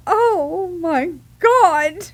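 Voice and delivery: drawn-out, high, mock-horrified cry; mortified exclamation. Patient caught passing gas by a stranger, mock horror. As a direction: stretch each word out in embarrassed shock. Retold shock at being seen.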